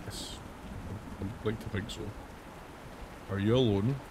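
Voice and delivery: normal talking voice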